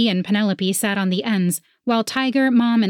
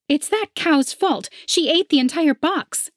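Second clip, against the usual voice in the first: scoffing rival; 9 semitones higher than usual. A narrator's reading. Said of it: "She scoffed".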